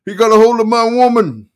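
high, loud anguished shout; enraged screaming attorney. Acted. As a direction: bellow it at the wall. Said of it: "he shouted".

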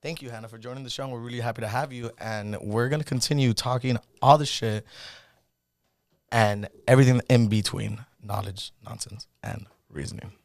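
host closing the episode, announcer voice; host sign-off. Acted. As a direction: wrap up the show like a radio host.

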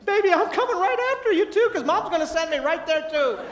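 high, loud mock-desperate dad; comic panic. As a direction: call out to the child in hammed-up alarm. Dad foresees mom killing him.

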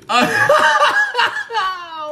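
long, high, loud cackle; burst of laughter. Real, not acted. Storyteller cracking up over the Wickets, long high helpless laugh. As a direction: lose it laughing.

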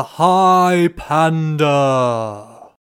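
very slow, loud stretched title call; drawn-out announcer call. Acted. Show title climax.

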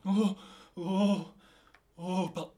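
loud rising trance moans; entering a trance. Pretend. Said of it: "que Bouddha veut me parler". (médium en transe)

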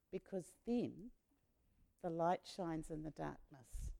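soft, slow, tender blessing; gentle closing. Real, not acted. Leader closing the talk about candles, soft and slow. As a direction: ease the group into a hopeful close.

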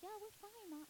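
high, soft little cover-up; falsely breezy reassurance. Retold or imitated. Lying to mom about having food, falsely breezy. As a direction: imitate brushing off a worried parent, high and light.

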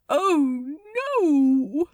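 loud, aghast exclamation; drawn-out dismay; horror. A narrator's reading. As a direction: groan it out in mounting horror. Horror at Crowley taking the floor.

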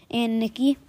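ordinary delivery, nothing marked

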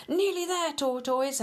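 high cheering call; cheering yell. Acted. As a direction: cheer from the crowd. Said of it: "yells of".

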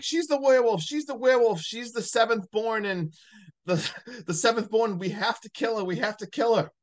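high, insistent mock panic; hammed-up frantic accusation; retold losing it. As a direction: imitate someone losing it, pitched high throughout. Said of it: "Joaquin loses it".

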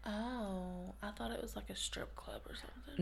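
hushed, embarrassed whisper. Real, not acted. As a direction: whisper the cheeky guess.

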